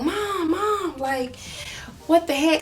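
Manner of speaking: put-on high, frightened cry of a child calling for her mom; retold panicked cry. A child sees her mom grabbed and cries out high to her. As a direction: call out to mom in high-pitched panic, half re-enacted. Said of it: "I started screaming and was, like, crying".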